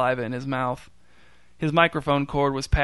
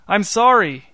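high loud remorseful call; shouted apology; 7 semitones higher, 8 dB louder than usual. A narrator's reading. Newton apologising to the fleeing gorilla, shouted apology. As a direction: call out after him, ashamed. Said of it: "Newton shouted after him".

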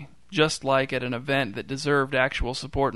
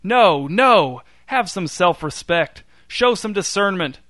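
high, loud, slow scolding yell; indignant shout; 5 semitones higher, 6 dB louder, slower than usual. A narrator's reading. Newton scolding the cheering circus crowd, angry shout. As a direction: berate the crowd, outraged. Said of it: "shouted Newton".

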